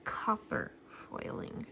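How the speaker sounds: copper foiling breathed softly and slowly; awed whisper. Real, not acted. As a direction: whisper "copper" in awe, slowed down. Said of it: "This is not gold foiling".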